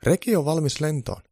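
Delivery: high elf voice; eager high-pitched little helpers; elves announcing. A narrator's reading. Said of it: "Saara ja Eero tontut sanovat yhdestä suusta".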